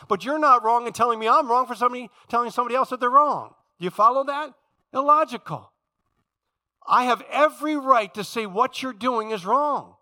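high, loud, insistent preacher; full-force preaching. Real, not acted. Preacher arguing his right to call out wrong, full-force preaching.

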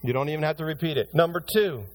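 high, loud, driving sermon delivery; fiery pulpit preaching; preaching at full force. Acted. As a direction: preach it hard to the congregation.

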